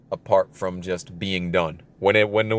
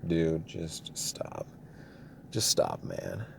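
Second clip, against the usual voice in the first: much lower and softer, slow, fading to a whisper; inner thought; 6 semitones lower, 13 dB quieter, much slower than usual. Retold or imitated. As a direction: mutter it to yourself in disbelief. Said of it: "and I'm thinking".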